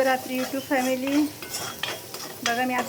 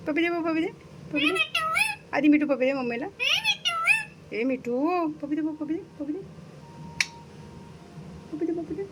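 coaxing for a kiss; 6 semitones higher than usual. Real, not acted. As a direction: sweetly beg the bird for a kiss. Begging parrot for a kiss.

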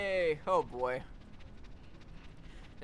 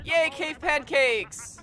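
gleeful cheer; 10 semitones higher, 7 dB louder, much slower than usual. Real, not acted. Gleeful cheer at squished caveman.

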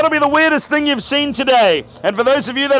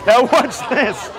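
theatrical magician; slower than usual. Acted. Magician's showman cue.

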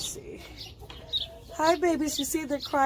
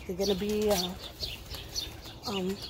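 usual speaking voice

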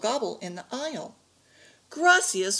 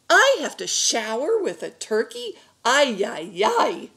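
loud, high-pitched complaint; exasperated outburst; 4 semitones higher, 6 dB louder than usual. A narrator's reading. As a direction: wail it in loud disbelief. Grandfather complains about turkey in shower.

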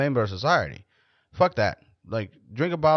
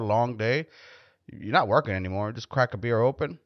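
ordinary talk

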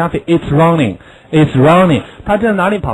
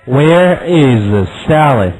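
slow, clear drill question; slow model question; slower than usual. Shown as a demonstration. Teacher modelling a question for the class. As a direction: say it slowly for pupils.

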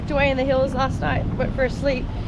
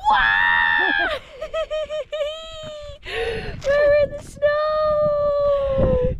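excited; much slower than usual. Real, not acted. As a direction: cry out at the snow. Seeing snow at dawn.

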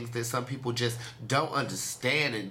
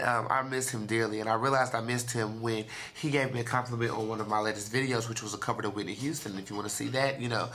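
normal talking voice

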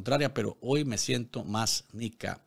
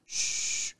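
unvoiced hushing shh; soft shush; 5 dB quieter than usual. Hushing his homeland in the poem, soft shush. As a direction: shush gently, like calming a child.

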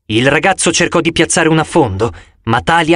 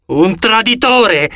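traditore much higher and slow, scornful; scornful echo; 7 semitones higher, slower than usual. A narrator's reading. Talia echoing the accusation at Annabeth, high and scornful. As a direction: throw traditore back in disbelief, drawn out. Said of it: "replicò Talia".

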